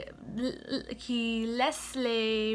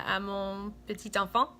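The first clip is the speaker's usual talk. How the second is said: ordinary talk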